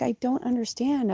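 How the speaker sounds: high, soft mock whimper; retold tearful confusion. Baffled owner to her cat, tearful. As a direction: imitate a helpless, tearful whine. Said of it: "And I started crying".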